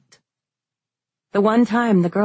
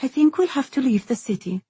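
resigned; 6 semitones higher than usual. A narrator's reading. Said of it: "tone final and shoulders sagging".

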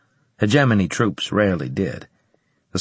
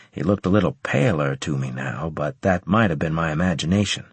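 usual speaking voice

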